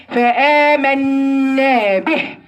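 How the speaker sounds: فآمنا به with the final هاء blown out slowly; over-breathed ha. Shown as a demonstration. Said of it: "حاتفين لي الهاء كده". (نفخ الهاء بمبالغة)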